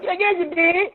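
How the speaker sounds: high, loud, fast accusing retort; mock accusing. His retold accusing reply.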